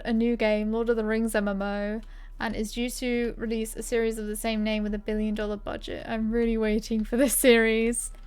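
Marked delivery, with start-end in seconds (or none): none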